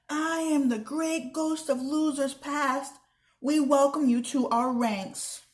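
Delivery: slow, high spooky ghost voice; ghostly sing-song. Acted. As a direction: moan it like a mock ghost. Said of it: "Ella sang".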